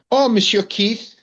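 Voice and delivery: high, bright put-on greeting; woman at the door. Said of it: "she says".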